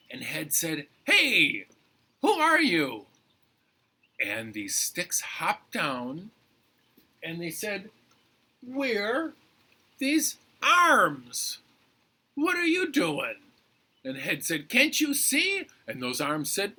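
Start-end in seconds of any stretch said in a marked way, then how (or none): 1.1-3.1 high, loud challenge; calling out. Acted. Head questioning the strange sticks, calling out. 8.6-13.4 high-pitched, loud character voice; indignant arms. Acted. Arms answering Head under the tree, indignant. Arms introduce themselves from story. 14.7-15.7 high, loud comeback; indignant retort. Acted. Head answering the arms, indignant retort.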